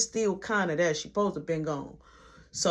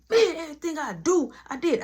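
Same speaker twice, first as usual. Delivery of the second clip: higher, frustrated outburst to himself; heated complaint; 8 semitones higher than usual. Retold or imitated. Cook in the back, venting heated frustration. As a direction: vent it hot and fed up.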